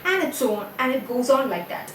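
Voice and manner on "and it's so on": ordinary talk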